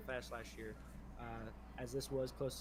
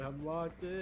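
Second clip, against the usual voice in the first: higher teasing echo of teammate; mocking imitation; 5 semitones higher, 4 dB louder, slower than usual. Teasing a fellow gamer over his catchphrase, mocking imitation. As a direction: parrot him back, pitched up. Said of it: "He said".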